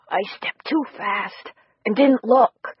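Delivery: slow, raspy and worn out; slow, scraped-out words after nearly drowning. Acted. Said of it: "his voice raspy".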